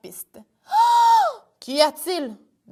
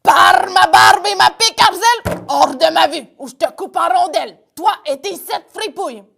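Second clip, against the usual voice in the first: big gruff pirate bellow, louder; pirate captain threatening; 5 semitones higher, 9 dB louder than usual. Acted. Cadog chases his brother Arzel off, angry bellow. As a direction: roar at him like a fierce captain.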